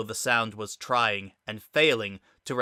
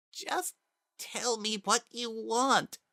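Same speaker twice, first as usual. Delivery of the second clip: low and broken; 12 semitones higher, slower than usual. A narrator's reading. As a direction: barely get the words out. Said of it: "so low and broken".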